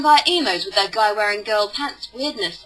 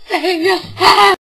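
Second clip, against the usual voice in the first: louder, higher, slow laughing wail; wailing mock plea; 8 semitones higher, 9 dB louder, much slower than usual. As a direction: stretch it into a comic despairing wail. Repeated mock warning, wailing.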